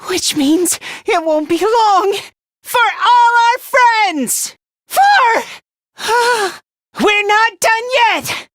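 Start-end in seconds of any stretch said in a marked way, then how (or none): 2.6-5.4 high, loud, stretched rallying shout; battle cry. Acted. 7.0-8.5 high, drawn-out determined call; rally them with a raised, stretched cry; defiant rallying cry. Acted. Rallying in battle.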